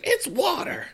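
high-pitched put-on Midwestern accent; hammed-up Midwestern squeal; mock Midwestern. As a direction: parody a Midwesterner. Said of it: "making fun of Midwesterners".

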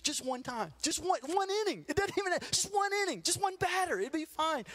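put-on high, pleading kid's begging. Boy pleading with his coach to let him pitch, high whine. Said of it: "I'd beg my coach".

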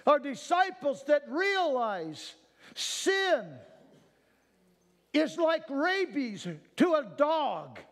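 high, loud, building preacher's cry; preaching at full force. Real, not acted. Preacher calling his flock to cry out, full-force preaching. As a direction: let it swell into a revival shout.

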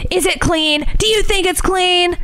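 a hint of a raging movie mother; lightly voiced Joan Crawford demanding; cleaning rage. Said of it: "my cleaning rages".

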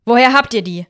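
deutlich lauter, erschrocken hervorgestoßen. A narrator's reading. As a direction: aufgeschreckt nachfragen. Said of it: "fragt Hans erschrocken". (Hans sieht seine Chat-Nachrichten, erschrocken)

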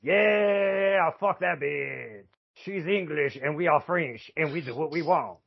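high, drawn-out put-on voice; hammed-up defiance; mock defiant nation. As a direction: play a haughty crowd waving off a rival. Voicing a defiant nation, put-on accent.

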